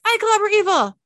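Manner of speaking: loud high toy voice, stress on I; action figure doll voice. Acted. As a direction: blare it like a talking toy. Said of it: "The action figure doll's voice says".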